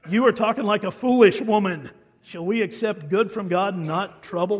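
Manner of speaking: loud, firm rebuke to a foolish speaker; stern rebuke. Retold or imitated. Job rebuking his wife's foolish advice, loud and firm. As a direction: scold her sharply. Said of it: "He replied".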